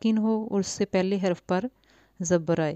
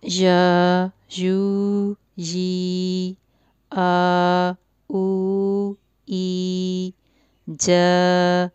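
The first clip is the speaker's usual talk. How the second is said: slow, clear sound-by-sound drill; recitation drill; 3 dB louder, much slower than usual. Shown as a demonstration. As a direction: chant each sound for the child to repeat. Drills letter sounds for a child. (بچوں کو حروف کی مشق کرانا، صاف صاف)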